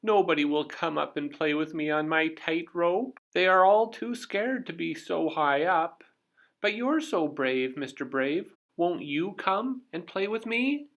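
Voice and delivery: put-on high, loud pleading girl voice; pleading little girl. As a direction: coax him up like an eager little girl. Miss Somersault pleads with Mister Brave.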